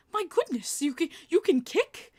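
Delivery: high, incredulous British impersonation; British accent. Retold or imitated. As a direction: imitate a British man in high astonishment. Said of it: "so the British guy".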